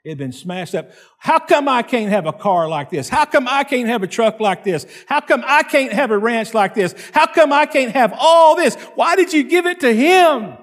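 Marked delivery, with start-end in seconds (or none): 1.2-10.6 building from high and loud to a much higher, louder wail; envious complaint to God. Retold or imitated. Preacher retells his envious prayer, building to a wail. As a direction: whine ever louder, like a jealous child crying to God. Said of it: "and I thought, "Lord".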